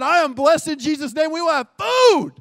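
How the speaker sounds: high, loud faith proclamation; proclaiming at the table, loud and high; shouted declaration. Retold or imitated.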